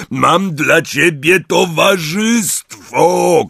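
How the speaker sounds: high, very slow, loud announcement; shouting. A narrator's reading. Hagrid announcing company to the giant, drawn-out shout. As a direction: call it out with long stretched words. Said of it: "krzyknął Hagrid".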